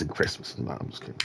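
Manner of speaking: whispering off-mic; whispered aside. Real, not acted. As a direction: drop to a whisper for the joke. Whispered aside after joke.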